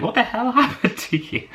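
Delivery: high, laughing what-the-hell; imagined aghast date. Retold or imitated. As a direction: play the shocked date she wished for, with a laugh. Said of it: "I'm almost aghast that he hasn't said".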